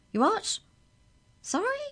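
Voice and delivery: a baffled mother on the phone; puzzled. Acted. Jake's mother puzzled by the caller, pitch shooting up.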